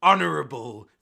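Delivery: loud, proud captain; captain's proclamation. Acted. Captain describing his own crew, loud and declaiming. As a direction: declaim it as a grand title. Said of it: "and the captain goes".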